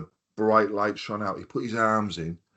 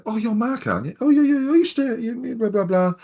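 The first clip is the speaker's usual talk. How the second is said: high-pitched, breezy imitation of the hotel boss; mimicking the boss; 14 semitones higher, 3 dB louder than usual. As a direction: squeak out his chatty reply, far above your own voice.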